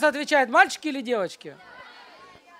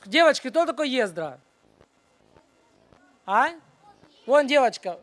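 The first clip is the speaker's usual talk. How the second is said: ordinary delivery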